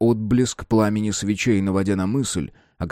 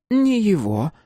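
correcting; 12 semitones higher, slower than usual. A narrator's reading. Beep corrects Simon.

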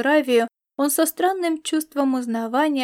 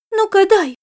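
high, loud, excited grab for it; eager demand; 8 semitones higher, 7 dB louder than usual. A narrator's reading. Star cat snatching the packet from Laszlo, eager demand. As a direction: demand it like an impatient cat-girl.

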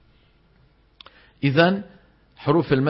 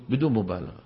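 understated; 7 semitones lower, 6 dB quieter than usual. Shown as a demonstration. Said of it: "حطوا جنبها عبارة إيه".

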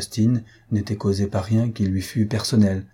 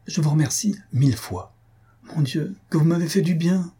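higher, heartfelt gratitude; fervent thanks; 6 semitones higher than usual. A narrator's reading. Countess thanks the count for saving her friend, fervently. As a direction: pour out thanks with fervour. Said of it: "d'un ton plein de ferveur".

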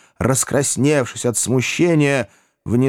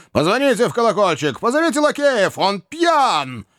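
much higher, agitated shouting orders; angry Wardle; 11 semitones higher than usual. A narrator's reading. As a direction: call for the footmen in a rage. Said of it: "заревел старик Уордль". (разгневанный старик зовёт лакеев)

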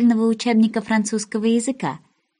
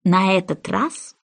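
ordinary delivery

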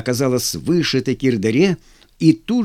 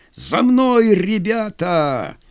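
high rallying shout, long on ребята; rally the men; battle cry; 6 semitones higher, much slower than usual. Acted. Officer charges into battle. (боевой клич)

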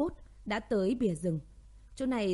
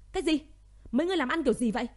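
loud furious yell down the phone; angry shout; 6 semitones higher, 5 dB louder, much faster than usual. Acted. General shouting at his men over the phone, angry. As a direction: blow up at the incompetent callers.

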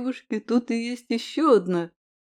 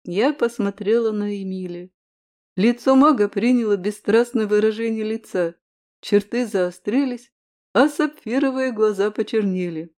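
plain reading voice

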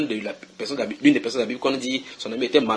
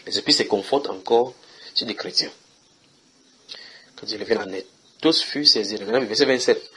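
ordinary delivery

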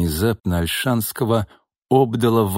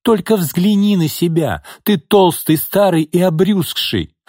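higher, louder mocking young woman; scornful mistress; 8 semitones higher, 3 dB louder than usual. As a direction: sneer at the fat old man. Lina voiced, high.

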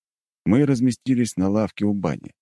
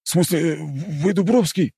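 hasty correction; 12 semitones higher, slower than usual. Acted. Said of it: "тут же поправился".

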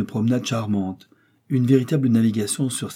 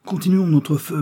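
higher, raised rallying call; urgent urging; 7 semitones higher, 4 dB louder than usual. A narrator's reading. Joey urges Dick to keep firing, urgent call. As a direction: press your partner to keep going.

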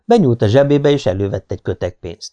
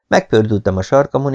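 ordinary delivery, nothing marked

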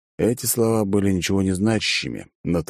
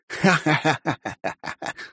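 madman's laugh; 9 semitones higher than usual. A narrator's reading. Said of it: "рассмеялся мужчина".

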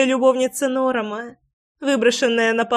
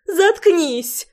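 shrill squeal; 8 semitones higher than usual. A narrator's reading. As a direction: shriek it at her, pitched way up. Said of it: "запищала Марина".

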